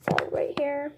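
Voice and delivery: soft breathy whisper, close to the mic; hushed ASMR whisper. Shown as a demonstration. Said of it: "I'm just gonna do some A S M R".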